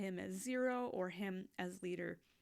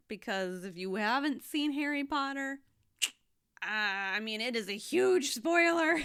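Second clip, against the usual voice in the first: giddy aside; 6 semitones higher, 9 dB louder than usual. Real, not acted. Gushing aside about spoilers.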